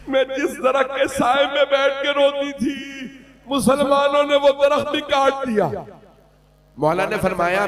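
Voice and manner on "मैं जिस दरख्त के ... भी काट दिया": raised, tearful voice; tearful complaint. Acted. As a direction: let the grief break through. Daughter weeping to Ali. (रोते हुए शिकायत)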